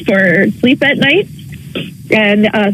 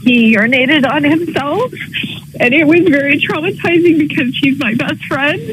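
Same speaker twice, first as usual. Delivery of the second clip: upset; 6 semitones higher than usual. Real, not acted. Caller upset about her dog.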